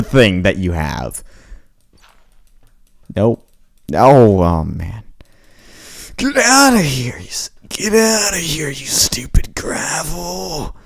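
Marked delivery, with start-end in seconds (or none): 6.2-10.8 high yell fading into a whispered grumble; frustrated yell to whisper. Real, not acted. Gamer yelling at gravel, then whispering the insult. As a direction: shoo the gravel, then mutter.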